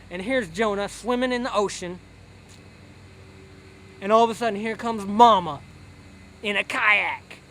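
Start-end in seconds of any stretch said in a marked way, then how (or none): none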